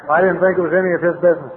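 ordinary delivery

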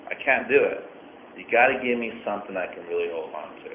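ordinary talk

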